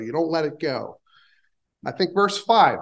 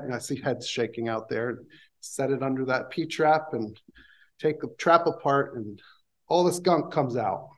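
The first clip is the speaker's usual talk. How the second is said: ordinary delivery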